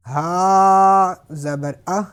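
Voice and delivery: اہ with the ہا sound breathed out clearly at the end; slow, loud teaching drill; breathy h sound. Shown as a demonstration. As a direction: let the ہا air come out audibly on اہ. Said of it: "ہا کی آواز بھی آپ نے نکالنی ہے".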